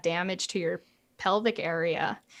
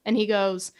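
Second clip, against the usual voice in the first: ordinary delivery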